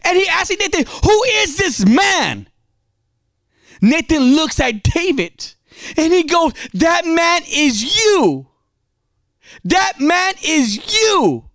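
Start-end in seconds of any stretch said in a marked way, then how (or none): 1.0-2.5 high, slow indignant demand; angry King David. Acted. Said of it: "He's angry". 6.7-11.5 slow, high-pitched pronouncement; Nathan's accusation. Acted. Nathan confronts David with his guilt.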